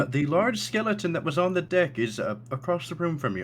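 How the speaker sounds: high, hesitant, courteous host; Thatch. Acted. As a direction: give a careful warning in a lifted character voice. Game master voices Thatch warning guest.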